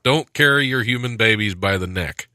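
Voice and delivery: usual speaking voice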